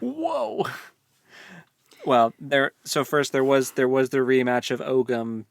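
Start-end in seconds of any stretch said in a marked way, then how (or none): 0.0-0.6 a sudden high, amazed "whoa"; surprised whoa. Real, not acted. Reacting to the former priest fighting her deity, amazed. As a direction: let it jump up in surprise.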